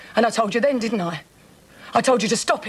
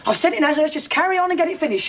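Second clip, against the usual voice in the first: agitated insistence; 6 semitones higher than usual. Acted. Brushes off an objection in a row.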